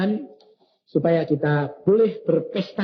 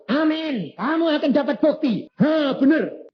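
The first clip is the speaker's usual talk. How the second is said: wife's voice; 11 semitones higher than usual. Retold or imitated. Wife voiced in retold story.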